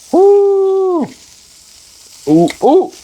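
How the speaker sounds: high delighted whoops; excited yelp. Real, not acted. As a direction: squeal with delight at the taste. Tasting the sauce off spoon.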